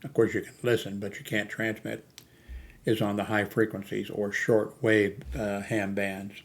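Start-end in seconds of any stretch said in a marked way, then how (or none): none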